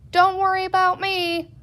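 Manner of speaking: high thin heroic voice. A narrator's reading. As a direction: speak thin and brave. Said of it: "in a thin voice".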